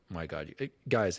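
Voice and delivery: normal talking voice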